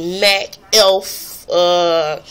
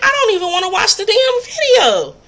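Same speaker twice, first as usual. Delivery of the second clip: exasperated; 13 semitones higher than usual. Retold or imitated. Retelling her own exasperation.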